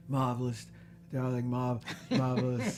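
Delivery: suave, theatrical director impression; affected showbiz praise; Peter Bogdanovich. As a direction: imitate a gentle, gushing film director. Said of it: "somebody like Peter Bogdanovich".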